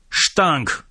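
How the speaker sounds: summoning a subordinate with a shout; shouted name. A narrator's reading. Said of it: "krzyknął oficer".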